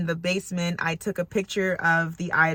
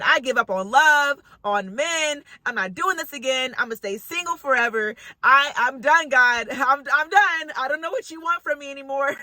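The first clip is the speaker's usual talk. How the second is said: raised, fed-up plea to God; giving up, higher and worked up; exasperated; 6 semitones higher than usual. Retold or imitated. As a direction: vent like you are done with it all. Retells her fed-up outburst to God.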